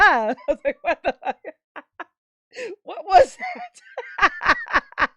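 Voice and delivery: high, loud and breathless with laughter; laughing hysterically. Real, not acted. Streamer reacting to a clip, laughing loud and high. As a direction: lose it laughing while talking.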